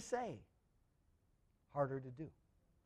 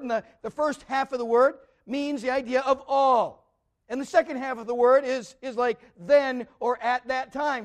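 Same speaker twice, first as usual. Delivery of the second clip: preaching at full force; 4 semitones higher, 7 dB louder than usual. Acted. Preacher explaining Greek words to the congregation, preaching hard. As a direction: drive the point home to the congregation.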